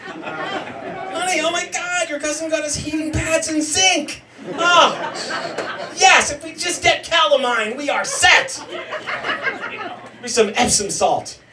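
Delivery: lightly voiced delighted wife; mock wife. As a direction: squeal over practical gifts. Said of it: "wife quoted about gifts".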